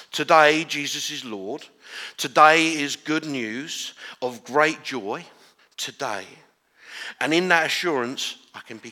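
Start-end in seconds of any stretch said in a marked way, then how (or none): 0.1-6.3 rising, emphatic preaching. Real, not acted. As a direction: build it up with conviction. Preaching climax.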